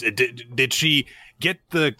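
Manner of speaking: ordinary delivery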